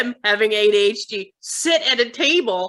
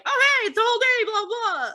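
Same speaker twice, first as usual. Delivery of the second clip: high, fast, sing-song cheer; a tired mom's brain racing, high and quick; chirpy morning burst; 13 semitones higher than usual. Retold or imitated. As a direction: bounce up wide awake and rattle it off. Mom describing her morning energy.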